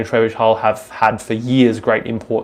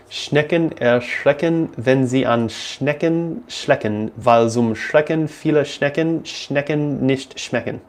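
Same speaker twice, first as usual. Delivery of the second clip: slow, deliberate German tongue twister; drawn-out, effortful German recital; careful tongue twister; 3 semitones higher, slower than usual. Shown as a demonstration. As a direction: pick carefully through each German word. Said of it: "German tongue twister with S C H I have problem".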